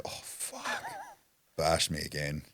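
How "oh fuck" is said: whispered dread. Retold or imitated. Dread as guard stops elevator.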